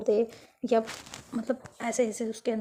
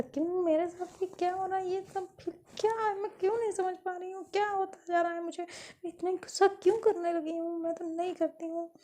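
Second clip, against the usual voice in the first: much higher, pleading questions to herself; confused inner voice; 9 semitones higher than usual. Acted. Girl asks herself why she is so angry, in a much higher bewildered voice. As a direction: spiral into bewildered self-doubt.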